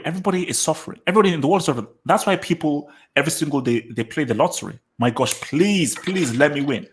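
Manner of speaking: ordinary talk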